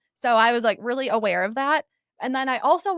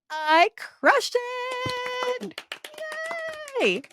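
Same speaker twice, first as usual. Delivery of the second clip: high, long drawn-out celebration; stretched, rising mini cheer; triumphant announcement; 12 semitones higher, much slower than usual. Real, not acted. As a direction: sing out the good news gleefully. Announcing audition success.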